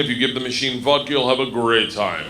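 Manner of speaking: put-on low Russian-accented voice; Igor. Gangster introducing a student to his associates, low Russian accent. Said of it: "And he says to me".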